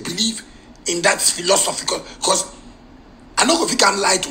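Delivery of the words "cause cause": ordinary delivery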